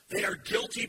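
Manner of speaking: fiery sermon voice; full-force preaching. Acted. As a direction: drive it home to the congregation.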